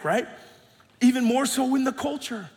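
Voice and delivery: ordinary talk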